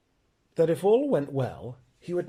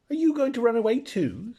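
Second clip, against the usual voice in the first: put-on eager boy; 10 semitones higher than usual. Boy Shasta voiced by reader.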